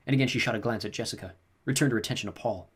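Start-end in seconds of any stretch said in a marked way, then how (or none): none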